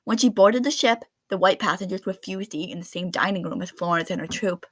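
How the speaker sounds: plain reading voice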